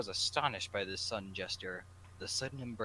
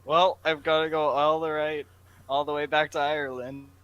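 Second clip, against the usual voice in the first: higher, louder grumbling Irishman; loud Jack voice; 7 semitones higher, 14 dB louder than usual. Acted. Jack grumbles to Mark about flying home, loud. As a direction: complain loudly about the long flight.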